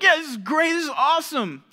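hammed-up thrilled squeal of approval; gushing excitement. New believer thrilled about the gospel, hammed-up excited. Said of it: "they get excited about it".

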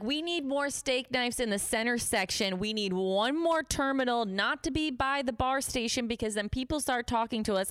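put-on high, insistent drunken list of demands; urgent demands. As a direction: replay an earnest rant to the boss. Acting out own drunk demands to boss.

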